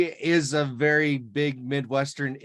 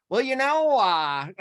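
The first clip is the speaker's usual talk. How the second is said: mild imitation of a West Virginia man, higher; a light hill-country "Well, you know, uh"; West Virginia drawl; 9 semitones higher than usual. Retelling a West Virginia local who talks like Donnie Baker, lightly. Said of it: "Every time he started a sentence".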